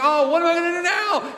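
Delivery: high, whiny mock worry; fretful inner voice. Traveler stuck at a decision, high mock dismay. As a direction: squeak out the helpless question. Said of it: "And you think".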